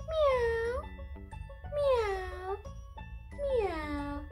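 high meow fading down; cat meow imitation. Shown as a demonstration. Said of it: "Let's do it together".

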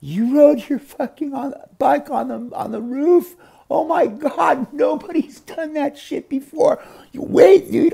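high squeaky voice of a tripping friend; stoned friend amazed. Retold or imitated. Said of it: "he's like".

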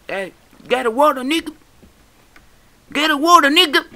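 very high, loud put-on voice; mimicked yelling. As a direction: yell it in mock panic. Yells catchphrase at game squid.

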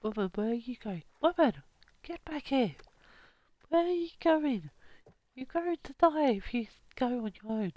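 high loud calling after; shouting. Acted. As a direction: yell after her across the lake. Calling after fleeing woman.

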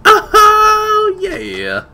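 high, loud cry of triumph; excited shout. Real, not acted. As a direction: yell it like you finally won. Finally found the tape.

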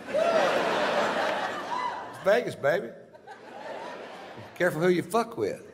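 mock boast.

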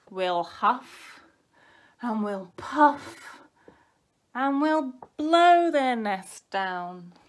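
slow, loud, building chant; big bad wolf threat. A narrator's reading. Wolf to chicks in their nest, slow loud threat.

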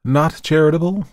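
high, rising, dismayed echo; gloomy question. A narrator's reading. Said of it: "returned the other gloomily".

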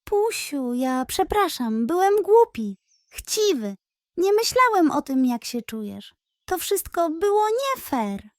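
lightly voiced pug apologizing to the pig; remorseful pug. A narrator's reading. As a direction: own up, ashamed and sorry.

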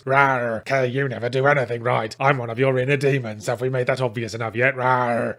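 lightly voiced silly monster growl; a hint of hammy inner demon; stupid monster voice. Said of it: "putting on a stupid monster voice".

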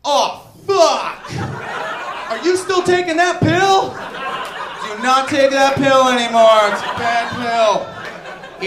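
loud, drawn-out backpedalling; sheepish scientist retracting a pill, loud and slow; panicked scientist. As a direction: take it all back in a loud slow panic. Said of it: "they show up two years later and they're like".

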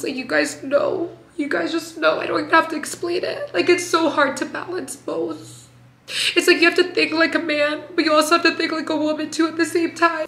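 high, tear-choked voice; talking through tears; teary. Real, not acted. To working moms watching, speaking while crying.

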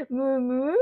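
cow mooing. Shown as a demonstration. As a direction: imitate a cow for a child. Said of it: "What does a cow say?".

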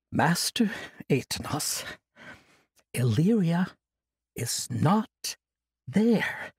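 slow, calm reassuring voice; calm him with measured words. Acted. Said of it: "Kasai spoke slowly and calmly".

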